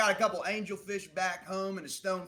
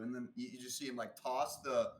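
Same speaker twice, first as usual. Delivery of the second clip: ordinary talk, nothing marked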